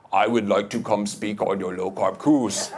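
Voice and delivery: mild imitation of a Swedish accent; fond, lightly voiced Swedish doctor; Andreas's Swedish accent. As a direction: do his Swedish-English lilt, lightly. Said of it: "That's how he talks".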